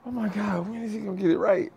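high-pitched, exasperated mom quote; frustrated mom. Retold or imitated. Frustrated mom asking when her son will get it, exasperated. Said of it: "even Mom got frustrated".

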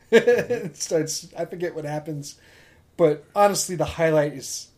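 ordinary talk

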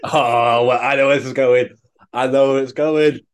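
amused groan of recognition; knowing laugh. Real, not acted. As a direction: chuckle like you see it coming. Anticipates punchline laughing.

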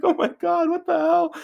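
shocked Brittany. Retold or imitated. Brittany in the cab seeing his head, a high alarmed cry. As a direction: play Brittany gasping at the wound.

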